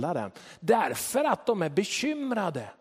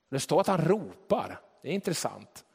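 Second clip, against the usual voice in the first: ordinary talk, nothing marked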